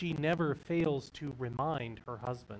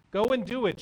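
loud, high, sharp command; 6 semitones higher, 8 dB louder, faster than usual. A narrator's reading. Wife kicks her husband out of the house, loud high command. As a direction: order him out the door. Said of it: "she commands".

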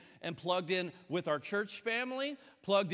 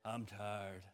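low, soft, worn-out impression; Nick; 7 semitones lower, 9 dB quieter than usual. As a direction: mimic a weary friend, low and quiet. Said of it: "He goes".

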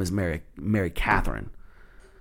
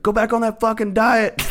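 laughing heckle; 12 semitones higher than usual. Retold or imitated. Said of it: "And I was like".

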